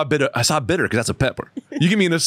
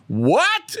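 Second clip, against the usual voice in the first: shocked reaction; 10 semitones higher than usual. Retold or imitated. Strangers react to the man's outburst, shocked. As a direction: cry out in mock disbelief.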